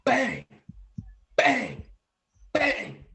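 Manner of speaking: three loud spoken bangs, pitch jumping up and down; mock gunshots. Telling friends about the attack, loud imitated gunshots. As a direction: punch out each bang like a gunshot.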